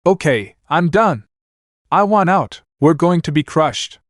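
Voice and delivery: half-screamed plea to get out; mild scream. Acted. Hecuba about to be crushed, mild scream. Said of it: "Hecuba screamed".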